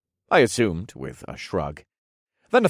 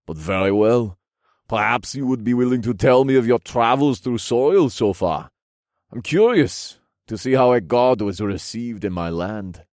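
louder, formal royal voice; regal king, curious; 3 semitones higher, 5 dB louder than usual. Acted. King asking about the hero's travels, regal curious voice. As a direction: request it with stately courtesy.

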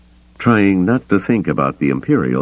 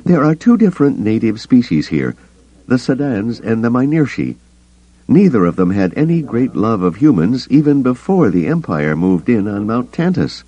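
higher, brisk wary woman; Mara character voice; 8 semitones higher, 3 dB louder than usual. A narrator's reading. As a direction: voice Mara as tense and guarded. Mara voiced, raised pitch.

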